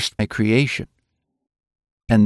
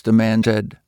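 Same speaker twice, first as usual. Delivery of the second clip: ordinary delivery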